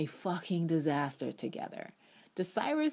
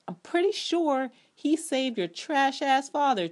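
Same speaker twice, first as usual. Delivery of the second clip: high, loud mocking jab; sassy rant; 9 semitones higher, 5 dB louder than usual. Real, not acted. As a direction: roast him with a loud sneer. Ranting at character.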